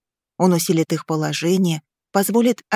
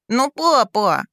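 put-on higher, protesting little boy's voice; indignant child; 4 semitones higher, slower than usual. A narrator's reading. Boy protesting to his father, indignant. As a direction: object like an indignant small son. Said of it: "возмутился ребёнок".

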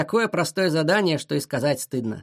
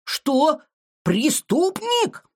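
very high, drawn-out outraged question; enraged disbelief; 10 semitones higher, much slower than usual. A narrator's reading. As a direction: flare up, stretching the word. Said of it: "рассвирепел Шиша". (рассвирепевший высокий голосок)

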